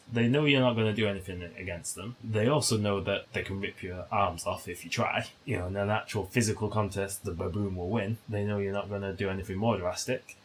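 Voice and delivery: normal talking voice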